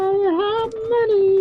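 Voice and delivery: slow, high, loud mimic; mock game voice. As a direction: stretch it out, mocking the game. Mocking the game voice line.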